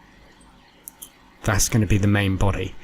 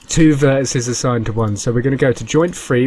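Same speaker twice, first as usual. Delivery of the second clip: ordinary delivery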